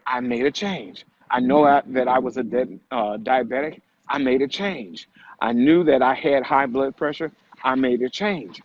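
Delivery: ordinary delivery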